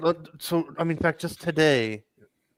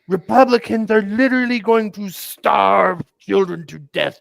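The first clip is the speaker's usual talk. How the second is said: higher, louder mock-hysterical outcry; mocking lefty alarm; 6 semitones higher, 7 dB louder than usual. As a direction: parody a panicked activist. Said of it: "some stupid lefty communist thing".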